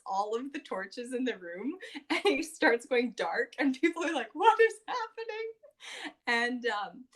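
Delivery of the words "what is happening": imitating a startled crowd. Crowd confused in darkness.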